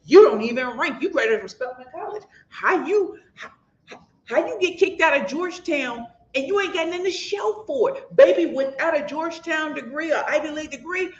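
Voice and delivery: high-pitched, sassy mock put-down; mocking her critics. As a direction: imitate snooty critics sizing someone up.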